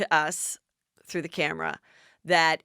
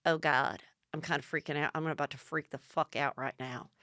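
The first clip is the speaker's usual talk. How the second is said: low, hushed imitation of someone freaking out; lowered, under-the-voice fretting; quiet mock panic; 5 semitones lower, 8 dB quieter than usual. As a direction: confide it softly, as if on edge. Sutton confides her panic to Dorit.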